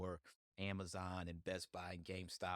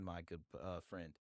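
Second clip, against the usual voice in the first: usual speaking voice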